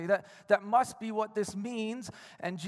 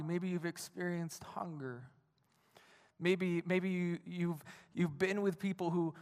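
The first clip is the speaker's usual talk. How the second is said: ordinary talk, nothing marked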